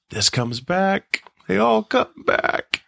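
slow, rising eerie delivery; spooky quote. Retold or imitated. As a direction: drag it out like a horror movie. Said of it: "what he's saying right there".

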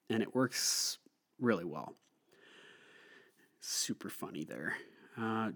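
ordinary delivery

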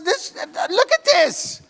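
high, stammering mock outrage; exasperated. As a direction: sputter it like an upset boy. Upset boy shows mother the ornament.

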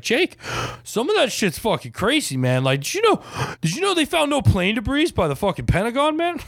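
high, wide-eyed excited dad; amazed dad. Retold or imitated. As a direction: play the dad who just discovered conspiracies. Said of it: "and he's like".

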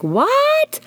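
stunned what. Retold or imitated. Hearing the puppy is found, shocked disbelief. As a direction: gasp it out in disbelief.

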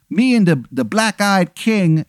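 high-pitched, hammed-up English accent; Henry the eighth, bad English accent. King greets Aaron, in a bad English accent. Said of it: "it's a very bad English accent".

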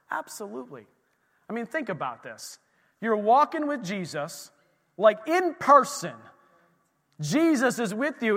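ordinary talk, nothing marked

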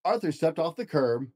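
storybook narration, gentle and lively; reading to children. Acted. Dad reads picture book to child, storybook voice. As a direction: read it aloud to a child at bedtime.

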